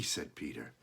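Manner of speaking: ordinary delivery, nothing marked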